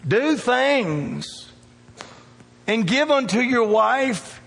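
rising revival preaching; preaching at full force. Acted. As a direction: lift it up and drive it home to the church.

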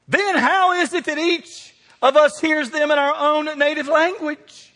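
high, slow, drawn-out question; mock bewilderment. Crowd hearing their own language, high astonished question. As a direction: stretch it out in acted disbelief.

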